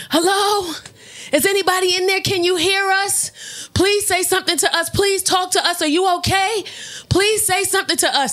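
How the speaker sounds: high, urgent calling out; worried bystander. Acted. Bystanders banging on the car door, calling urgently. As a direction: plead for any answer, pitched high.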